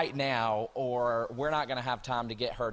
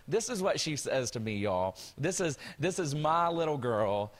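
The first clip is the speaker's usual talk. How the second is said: ordinary talk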